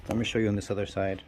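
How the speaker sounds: usual speaking voice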